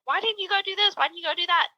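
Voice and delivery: high-pitched nagging questions; second-guessing critic. Retold or imitated. Imitating people judging those with these stories, high second-guessing.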